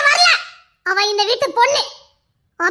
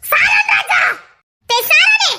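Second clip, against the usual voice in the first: calling out; 8 semitones higher, much slower than usual. Acted. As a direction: shout his name so he hears. Calling out for Saran.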